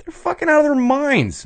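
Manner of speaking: hammed-up high disbelief; high mock outrage. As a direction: shriek it like it's absurd. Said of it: "we're like".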